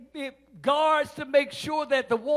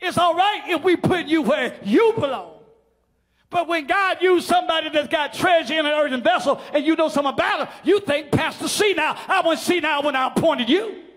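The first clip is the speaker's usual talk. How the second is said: full-force preaching; 5 semitones higher, 10 dB louder than usual. Acted. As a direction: preach it hard to the congregation.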